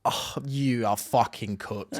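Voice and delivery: slow, drawn-out exasperation; slow disbelief. Real, not acted. Host dumbfounded by co-host's answer, slow disbelief. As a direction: weigh out each word, aghast.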